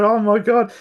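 high, loud burst of astonishment to his wife; retold amazement. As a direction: throw it out like you can't believe the ad. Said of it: "I turned to my wife and I said".

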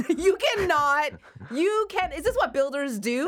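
high, loud, slow disbelief; sputtering outrage, slowed down; exasperated. Retold or imitated. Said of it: "And I'm like".

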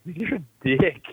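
small, high-pitched voice, lightly put on; a hint of a tiny conscience piping up; little inner voice. Voice in his head scolding him, little inner voice. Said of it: "the little voice in the back of my head".

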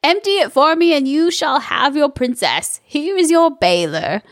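lightly voiced commanding witch; a hint of a bossy old witch. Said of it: "A plus witch voice".

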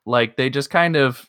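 ordinary talk

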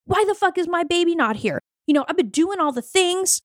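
mock pissed-off demand. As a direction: demand it like you're owed. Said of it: "and then get pissed".

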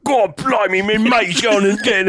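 high, hammed-up garbled Cockney accent; thick British accent. Host mimics distraught British diver, thick British accent. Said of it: "in such a thick accent".

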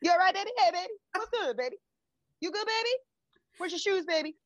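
put-on high, sugary baby-talk calls; cooing date. Mimicking the date calling his girl baby, high cooing. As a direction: coo each baby up high like a smitten guy.

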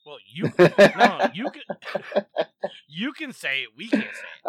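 laughing while saying it. Real, not acted. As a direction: laugh through the words. Laughing through the reply.

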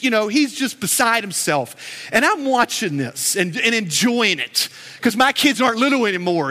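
normal talking voice

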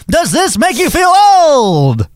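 high, slow, drawn-out shout; shouted catchphrase. Pretend. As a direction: belt out the show title like a host.

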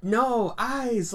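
high, loud dismayed cry; mock outcry. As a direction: yelp it in horror. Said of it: "I'm just like".

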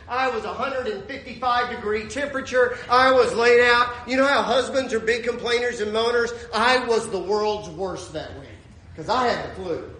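ordinary talk

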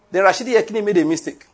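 ordinary delivery

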